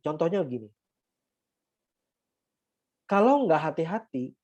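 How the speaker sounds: ordinary delivery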